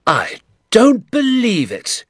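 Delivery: high, loud, drawn-out disbelief; incredulous cry. A narrator's reading. Doctor hearing of the pit, incredulous cry. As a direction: cry out, stretching the words in shock. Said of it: "he cried".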